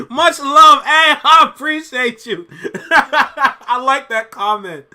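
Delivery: loud delighted laughter while thanking; laughing delight. Real, not acted. Delighted by a viewer comment.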